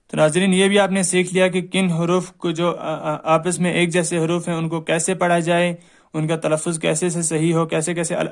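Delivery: ordinary talk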